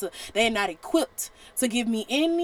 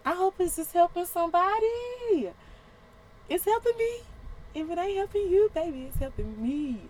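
high, bright exclamation; a vlogger thrilled her message helps, pitched high; excited aside; 8 semitones higher than usual. Real, not acted. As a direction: share your joy, pitched up.

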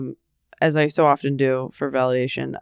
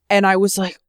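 ordinary talk

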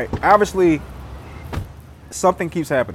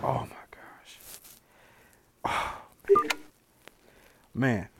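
breathless stunned whisper; soft drained whisper of disbelief; exhausted whisper; 4 semitones lower, 14 dB quieter, slower than usual. Real, not acted. Stunned after torment mission.

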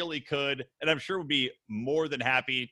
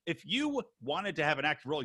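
usual speaking voice

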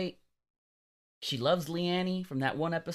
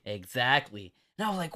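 ordinary talk, nothing marked

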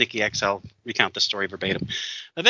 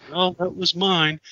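put-on higher, slow drunk confession; sheepish admission; 7 semitones higher, slower than usual. Drunk man admitting his fault, slow and higher. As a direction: own it sheepishly.

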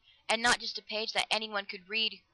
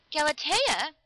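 high, louder, alarmed cry; distracted cry; 8 semitones higher, 6 dB louder, slower than usual. A narrator's reading. Lover crying out at her terrible idea, distractedly. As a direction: call out in shock. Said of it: "he cried distractedly".

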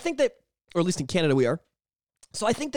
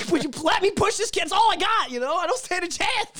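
high laughing mock plea; pleading kid; 10 semitones higher than usual. Kid begs the teacher to let him push, high mock plea. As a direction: beg the teacher, pitched up.

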